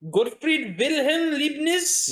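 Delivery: high, loud, drawn-out mock German; hammed-up German accent, slow and rising. Showing off the mathematician's full name in a mock German accent. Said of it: "I'm allowed to do a German accent".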